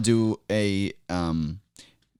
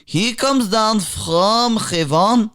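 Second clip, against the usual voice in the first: put-on slow, much higher, prophetic Middle Eastern accent; Middle Eastern accent, preaching; 10 semitones higher, slower than usual. Guest hailing the host as savior in Middle Eastern accent.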